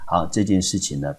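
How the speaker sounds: usual speaking voice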